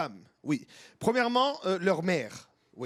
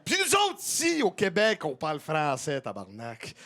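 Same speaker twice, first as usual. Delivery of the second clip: put-on Quebec accent; 5 semitones lower than usual. Impersonates a Quebecer.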